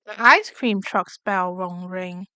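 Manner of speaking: ordinary delivery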